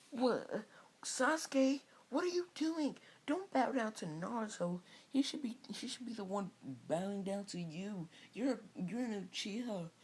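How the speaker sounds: high-pitched schoolgirl scolding Sasuke; Sakura voice. Retold or imitated. Narrator voices Sakura pleading.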